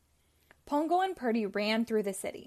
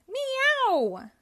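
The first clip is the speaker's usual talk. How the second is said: high, loud cat screech; cat leaping into the attack; 13 semitones higher, 5 dB louder than usual. Acted. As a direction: let out a shrieking meow. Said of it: "screeched Tibbs".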